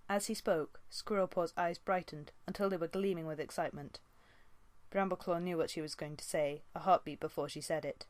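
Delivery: ordinary delivery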